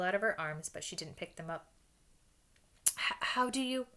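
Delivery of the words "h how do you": higher, halting, stunned question; shocked stammer. A narrator's reading. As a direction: stumble on the first word in shock. Shocked professor stammers at the news.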